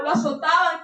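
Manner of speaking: ordinary delivery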